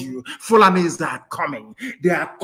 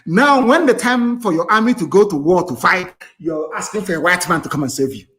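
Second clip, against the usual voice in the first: normal talking voice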